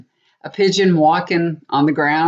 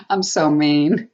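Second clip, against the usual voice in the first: ordinary talk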